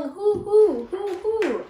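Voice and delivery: high owl hoo; little mouse imitating the ghost's call, high hoots. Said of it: "It keeps calling".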